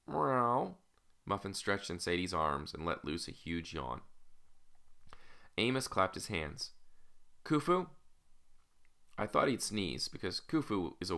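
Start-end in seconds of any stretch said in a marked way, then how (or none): 0.1-0.8 high imitated cat meow. A narrator's reading. Cat yawning in the girl's arms, high meow. 7.4-7.9 high-pitched summoning call. A narrator's reading. Master summoning his baboon, high questioning call.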